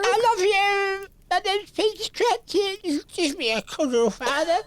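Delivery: dopey Peter voice. Pretend. Host mocks Peter Cratchit with a high, slow, dim voice. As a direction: play Peter as a slow, simple lad.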